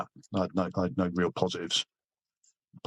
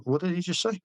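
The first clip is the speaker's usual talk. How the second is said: incredulous retold question; 10 semitones higher than usual. Retold disbelief at interpreter.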